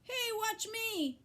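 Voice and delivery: high, showing-off yell; boy yelling to be watched. A narrator's reading. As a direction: shout for attention like a bratty boy. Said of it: "yelled Avery".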